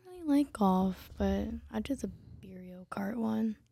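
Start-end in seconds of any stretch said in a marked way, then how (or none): 1.2-3.6 whispering. Real, not acted. Aside to the group.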